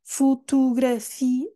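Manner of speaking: Fotografia said slowly, moving quickly to the fi; slow model. Shown as a demonstration. Teacher showing how Fotografia moves to the fi. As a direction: say Fotografia slowly, heading straight for the fi. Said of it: "eu vou logo para o fi".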